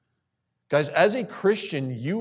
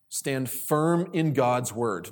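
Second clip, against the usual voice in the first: slow emphatic repeat; 3 semitones lower, much slower than usual. Shown as a demonstration. Repeats point slowly for emphasis.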